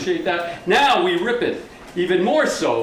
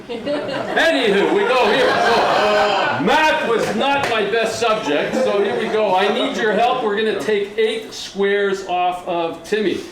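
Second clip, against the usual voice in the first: ordinary delivery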